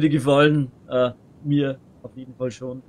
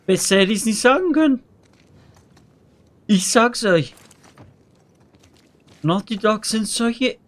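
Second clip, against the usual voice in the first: escalating comedy rant; 8 semitones higher, 6 dB louder than usual. Real, not acted. Ranting about Naughty Dog after shock.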